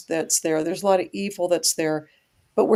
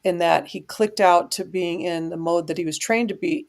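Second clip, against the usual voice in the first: ordinary talk, nothing marked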